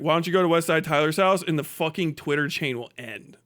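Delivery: ordinary delivery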